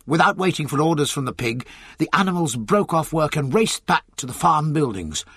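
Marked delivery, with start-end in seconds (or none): none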